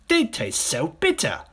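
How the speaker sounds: high, loud, slow complaint; disgusted smaller crocodile. Acted. Smaller crocodile complaining of the taste, high and disgusted. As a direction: stretch it out in distaste.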